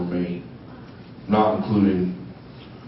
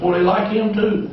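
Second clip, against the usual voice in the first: higher, amazed aside; mock surprise; 10 semitones higher than usual. Newcomer at his first meeting, mildly amazed. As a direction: marvel at it like a naive newcomer. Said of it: "I said".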